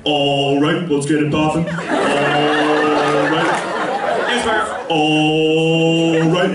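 a lightly voiced pumped-up teacher; teacher impression. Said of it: "he goes".

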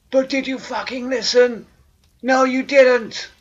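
high, loud furious yelling; angry shout. Acted. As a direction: scream it at them, slowing on the last words. Yelling at the dead.

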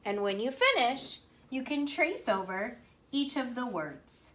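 ordinary talk, nothing marked

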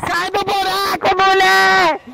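high frantic yelling; panicked yell. Real, not acted. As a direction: yell at her to get out.

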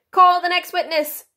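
high, loud royal summons; King. A narrator's reading. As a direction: call out in a ringing kingly voice. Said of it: "said the King".

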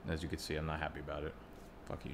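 usual speaking voice

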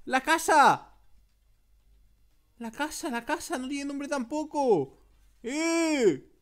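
high, loud cry of hammed-up alarm; shocked discovery. Gamer discovering the house has no name, shocked outcry. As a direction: blurt it out, aghast.

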